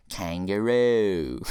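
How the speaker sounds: put-on slow, drawn-out Australian accent; exaggerated Aussie twang. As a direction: stretch it out in a hammy Australian accent. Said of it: "And I'm in an Australian accent".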